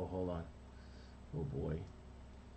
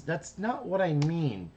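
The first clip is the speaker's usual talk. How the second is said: higher, slow exasperated voice; slow exasperated cartoon big brother; 7 semitones higher, slower than usual. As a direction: say it patiently, a bit fed up. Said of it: "And he says".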